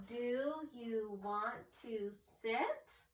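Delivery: slow word-by-word reading, SIT rising; question rising at the end. Shown as a demonstration. Teacher reads a sentence to young readers, rising question. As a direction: read slowly, lift the pitch on "sit". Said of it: "have your voice go up at the very end".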